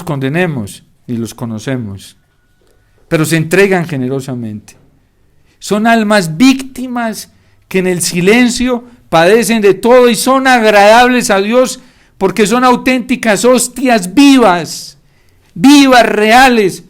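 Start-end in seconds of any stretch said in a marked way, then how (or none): 5.6-16.8 high, loud, slow fervent build; impassioned sermon peak; fervent preaching. Real, not acted. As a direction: drive the words home with passion.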